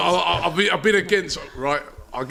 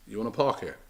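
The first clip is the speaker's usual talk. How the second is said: low, hushed question; mimicked shady offer; 6 semitones lower, 9 dB quieter than usual. Street kid sidles up to a parking driver, low and shady. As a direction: play the dodgy kid, low and soft.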